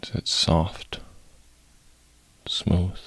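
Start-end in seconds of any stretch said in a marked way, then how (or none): none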